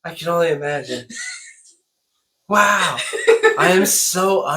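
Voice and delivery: loud, high amazed disbelief. Real, not acted. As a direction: react stunned, laughing. Amazed reaction to friend.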